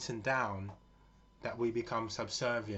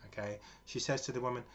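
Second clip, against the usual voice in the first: usual speaking voice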